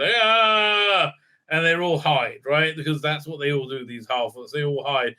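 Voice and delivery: usual speaking voice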